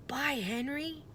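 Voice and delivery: soft whispered farewell to the pteranodon; whispered goodbye. A narrator's reading. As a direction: barely breathe the goodbye. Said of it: "whispered Jack".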